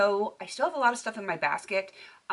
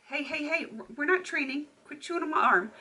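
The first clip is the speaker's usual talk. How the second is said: scolding dog; 7 semitones higher than usual. Real, not acted. Puppy chewing her arm.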